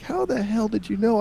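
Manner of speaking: high astonished mom voice; amazed she found it; mock mom amazed. Mom finding the stash, high amazed. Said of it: "be like".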